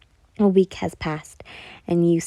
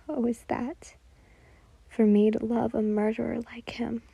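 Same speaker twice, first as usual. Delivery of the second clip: soft, higher heartbroken hush; 4 semitones higher, 6 dB quieter than usual. A narrator's reading. Yen admitting to Todoroki she still loves Kirishima, softly heartbroken. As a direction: confess it quietly, heart breaking. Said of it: "I could hear the heartbreak in her voice".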